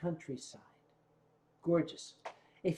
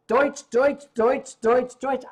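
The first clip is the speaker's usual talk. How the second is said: mock German babble; 6 semitones higher, 8 dB louder, slower than usual. Mocking Germans' chatter.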